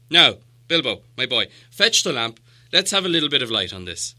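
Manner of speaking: put-on loud, firm old wizard insisting; commanding wizard. Gandalf insists on Bilbo.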